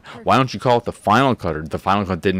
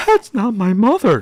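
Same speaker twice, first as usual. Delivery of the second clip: imitating Rachel; 11 semitones higher, 4 dB louder than usual. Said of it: "Rachel is like".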